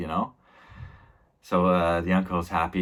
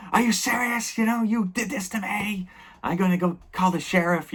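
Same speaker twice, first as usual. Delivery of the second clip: put-on much higher, indignant teenage whine; whiny outraged nephew. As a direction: protest shrilly at the uncle. Nephew outraged at his uncle.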